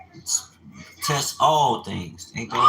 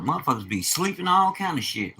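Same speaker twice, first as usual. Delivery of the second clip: usual speaking voice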